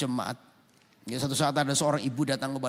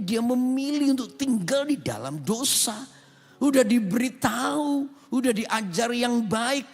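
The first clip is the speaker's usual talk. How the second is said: raised, forceful pulpit voice; preaching; 10 semitones higher, 6 dB louder than usual. Acted. Pastor preaching hard to his congregation, forceful. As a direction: drive the point home to the church.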